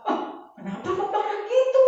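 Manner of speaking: high, startled question; retold shock. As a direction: re-enact being taken aback by an angry tone. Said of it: "saya kagetnya itu apa?". (menirukan rasa kaget, nada melengking tinggi)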